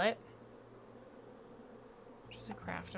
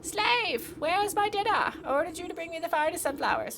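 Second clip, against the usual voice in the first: much higher, louder imperious shriek; shrill queen bee; 9 semitones higher, 8 dB louder than usual. Acted. Queen bee demands dinner from the beekeeper, shrill and imperious. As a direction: shriek orders at your servant. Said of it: "A shrill voice pierces the meadow".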